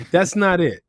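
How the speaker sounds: ordinary talk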